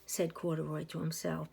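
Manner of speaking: usual speaking voice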